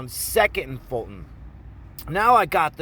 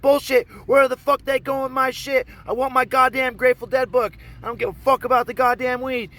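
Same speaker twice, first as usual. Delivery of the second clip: high, loud angry tirade; acted furious shouting; 9 semitones higher, 5 dB louder than usual. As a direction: shout her down, demanding your stuff.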